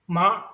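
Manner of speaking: "ma" with a sharp falling tone; fourth tone. Shown as a demonstration. Teacher shows fourth tone meaning scold, "ma" falling sharply. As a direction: drop the pitch quickly on a short, loud "ma". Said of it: "The fourth tone is".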